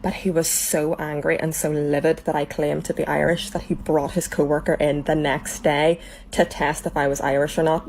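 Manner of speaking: ordinary talk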